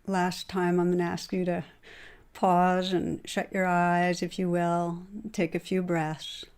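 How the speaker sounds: gentle closing meditation; guided meditation. Acted. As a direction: ease the listeners into stillness.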